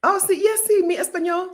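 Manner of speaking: playful, high-pitched mock Spanish accent. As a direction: show off a Spanish accent for laughs. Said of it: "my Spanish accent is so good".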